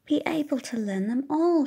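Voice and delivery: Tilly fretting about rules, lightly voiced; child wondering. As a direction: puzzle it out as a small girl. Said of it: "she wonders".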